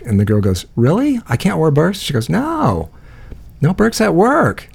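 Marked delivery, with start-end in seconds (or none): 0.8-2.0 mock surprise. New girl voiced in story. 2.3-4.7 mock-insistent high voice saying no; a lightly voiced barista laying down the rule, slow and high.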